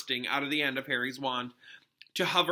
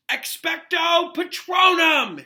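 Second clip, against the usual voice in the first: high, very slow, loud bellow; boy bellowing the spell at the Dementor; 11 dB louder, much slower than usual. A narrator's reading. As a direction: bellow the charm out. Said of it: "Harry bellowed".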